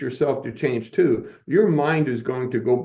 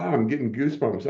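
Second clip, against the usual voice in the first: ordinary talk, nothing marked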